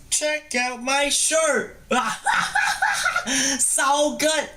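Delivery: high, slow put-on greeting voice; hammed-up goofy greeting, drawn out; goofy intro voice. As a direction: hype up the viewers in a silly high voice.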